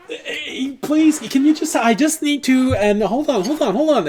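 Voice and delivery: very high, flustered begging; pleading gnome. Acted. Gnome begging to stay on the intercom, high-pitched pleading.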